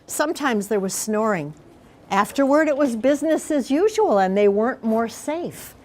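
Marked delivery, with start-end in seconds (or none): none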